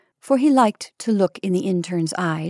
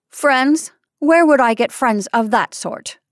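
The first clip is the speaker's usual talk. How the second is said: higher, rising echo, then falling retort; scornful disbelief; 5 semitones higher, 4 dB louder than usual. A narrator's reading. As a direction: throw the suggestion back at him. Patient scorns the intern's suggestion.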